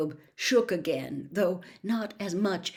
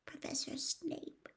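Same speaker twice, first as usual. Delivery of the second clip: Professor Snape breathed out slowly, voiceless and much softer; a frightened boy's whispered confession; barely a whisper; 7 semitones higher, 13 dB quieter, slower than usual. Acted. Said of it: "in barely more than a whisper".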